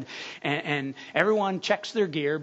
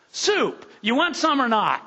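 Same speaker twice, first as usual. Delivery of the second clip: higher, louder curt snap; impatient cook; 7 semitones higher, 5 dB louder than usual. Acted. Cook snapping at a customer over soup, impatient. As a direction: push the customer to order already.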